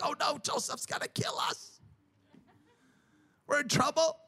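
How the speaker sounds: hint of frightened brothers fearing Joseph; mock terrified brothers. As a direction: play the brothers lightly scared for their lives. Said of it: "They're terrified".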